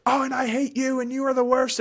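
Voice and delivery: put-on high, loud bratty child interrupting; angry child. Child lashing out at parent mid-argument, high and loud. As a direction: shriek it like a furious kid. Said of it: "Or you can say".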